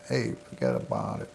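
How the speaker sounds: low-pitched mock Brooklyn Italian accent. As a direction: drop deep into an imitated Brooklyn Italian accent. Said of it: "Joey has the Brooklyn Italian accent".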